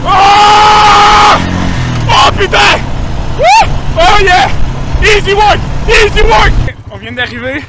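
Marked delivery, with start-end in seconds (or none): 0.0-6.5 high, loud whoops of thrill; excited shouting. Real, not acted. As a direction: whoop it out with joy. Thrilled on the ATV ride.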